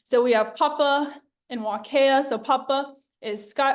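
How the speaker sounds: usual speaking voice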